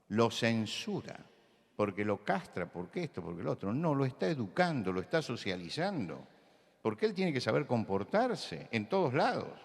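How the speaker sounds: ordinary talk, nothing marked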